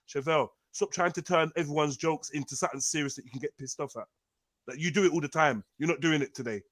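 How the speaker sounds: low, quiet and stern; calm threatening tone; cold warning. Real, not acted.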